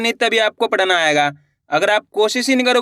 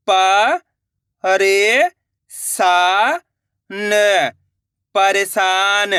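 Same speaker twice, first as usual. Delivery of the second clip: slow blended reading; much slower than usual. Shown as a demonstration. Teacher showing pupils how to blend, said slowly. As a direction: stretch each sound slowly into one word. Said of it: "अब आगे देखिए".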